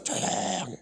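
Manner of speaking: barely voiced; hushed. Real, not acted. Admiring the quiet bride, hushed. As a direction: let the word almost vanish.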